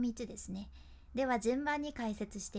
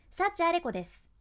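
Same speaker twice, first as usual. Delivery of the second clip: ending dropped hard and heavy; over-lowered ending; 5 semitones higher, 6 dB louder than usual. Shown as a demonstration. Teacher demos a harsh, over-lowered ending. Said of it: "これぐらい下げるとちょっときつい印象".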